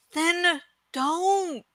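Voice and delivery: calm and gentle, lightly voiced; Saphira. Dragon soothing grieving Eragon, soft gentle voice.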